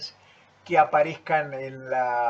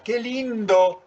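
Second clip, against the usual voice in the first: "qué lindo" sung out high, slow and loud, truly delighted; admiring "qué lindo"; 7 semitones higher, 5 dB louder, slower than usual. Shown as a demonstration. Teacher showing intonation to students, delighted and high. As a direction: say "qué lindo" as if you really mean it, rising high. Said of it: "Con una entonación damos vuelta el sentido".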